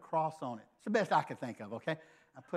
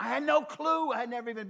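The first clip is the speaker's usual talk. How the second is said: exclaimed; 9 semitones higher, 4 dB louder than usual. Real, not acted. Confessing total ignorance, exclaimed.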